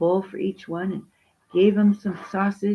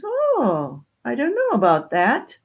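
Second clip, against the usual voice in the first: high, swooping contradiction; raised voice, airily sceptical; doubting peacock; 6 semitones higher than usual. Acted. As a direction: lift the pitch on a knowing disagreement. Peacock doubts the dog's words.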